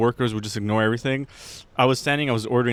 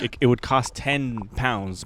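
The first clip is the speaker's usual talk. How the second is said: usual speaking voice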